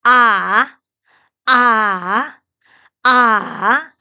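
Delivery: loud 아 dipping down then back up; dipping third tone. Shown as a demonstration. Teacher demonstrating Mandarin third tone, dipping 아. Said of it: "아래 위로".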